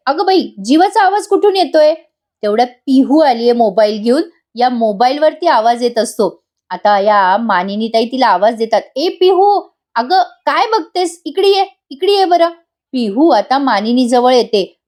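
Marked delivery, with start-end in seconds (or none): 0.1-1.9 high, startled exclamation; a sudden high cry of surprise. Acted. Mother-in-law hearing her son's voice from nowhere, startled. 9.0-12.5 high call across the room. Acted. Mother-in-law calling Pihu over to her, raised high call.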